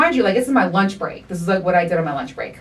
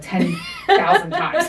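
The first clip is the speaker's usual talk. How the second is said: higher, slow, laughing through the words; laughing demand; 10 semitones higher, slower than usual. Retold or imitated. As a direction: stretch it out while cracking up.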